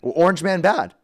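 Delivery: sarcastic put-on streamer voice; mock agreement in the show voice. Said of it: "I'm done with the voice".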